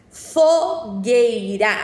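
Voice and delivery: fogueira drawn out syllable by syllable; slow dictation. Shown as a demonstration. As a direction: dictate fogueira slowly and clearly. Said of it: "Vou falar pausadamente".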